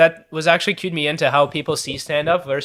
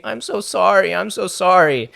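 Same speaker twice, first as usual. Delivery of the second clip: higher-pitched mock weeping apology; mock bawling; 5 semitones higher, slower than usual. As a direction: imitate a tearful girl apologizing, higher. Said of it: "And she's bawling and she's saying".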